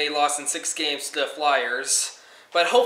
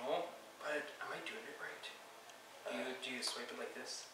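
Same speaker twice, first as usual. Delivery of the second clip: hushed whisper; 3 semitones lower, 22 dB quieter than usual. Acted. Hushed play-acting a swipe.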